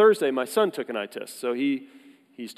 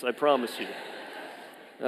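slow and softer, breaking into laughter; laughing promise; 2 semitones lower, 6 dB quieter, much slower than usual. Real, not acted. Pastor promising the congregation, laughing softly. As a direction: reassure them while laughing.

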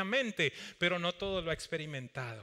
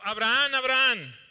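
loud call from heaven, rising then falling; 4 semitones higher, 7 dB louder than usual. Acted. The angel calls Abraham from heaven, loud call. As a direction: call his name twice from afar. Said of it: "el ángel del Señor le gritó desde el cielo".

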